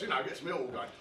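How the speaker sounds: ordinary talk, nothing marked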